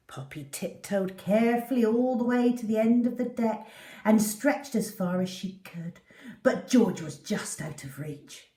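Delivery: ordinary delivery, nothing marked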